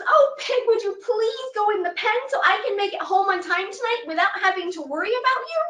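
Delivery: put-on high, pleading old woman voice; old woman begging. Old woman begs her pig to go in the pen. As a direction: beg the pig sweetly. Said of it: "So she begs the pig".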